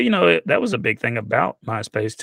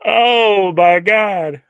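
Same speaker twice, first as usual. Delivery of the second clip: laughing; 10 semitones higher, 8 dB louder, much slower than usual. Real, not acted. Laughing fit after joke.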